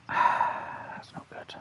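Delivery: slow, breathy whisper with a sigh. Real, not acted. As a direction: let it out in a weary hush. Sighing quietly at bad spot.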